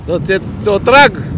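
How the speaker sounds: swearing at a driver who cut him off; angry curse. Real, not acted. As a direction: spit it out at them. Cursing at a driver.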